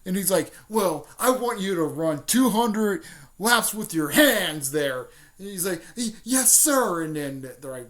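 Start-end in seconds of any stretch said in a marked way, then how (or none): 0.7-5.1 loud, raised-pitch coach's command; acted drill-master order; mock stern order. Sensei orders punishment laps. 6.0-7.0 high, loud yes-sir; acted snap-to reply, pitched up; mock obedient reply. Lee obeys sensei's order.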